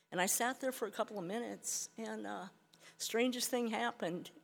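ordinary talk, nothing marked

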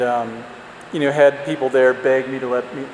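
ordinary talk, nothing marked

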